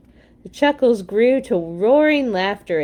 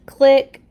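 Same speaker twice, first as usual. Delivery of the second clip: a bright, louder "Click" like a shutter snapping; camera click; 5 semitones higher, 8 dB louder than usual. A narrator's reading. Narrator voicing the camera's shutter, a crisp click. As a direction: pop the word out like a camera shutter. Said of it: "said the camera".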